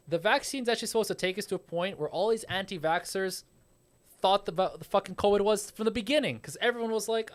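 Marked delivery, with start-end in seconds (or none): none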